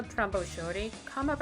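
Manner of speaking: ordinary delivery, nothing marked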